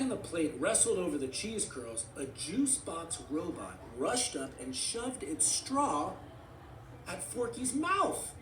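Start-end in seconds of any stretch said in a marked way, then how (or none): none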